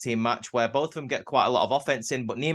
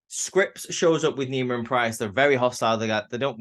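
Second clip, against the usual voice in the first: usual speaking voice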